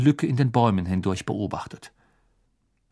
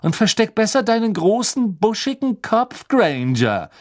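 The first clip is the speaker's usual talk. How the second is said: high, mocking jeer, slowing on the insult; mock her to her face; sneering jeer; 10 semitones higher, 4 dB louder than usual. A narrator's reading. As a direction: Draco jeering at Hermione. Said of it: "höhnte Malfoy".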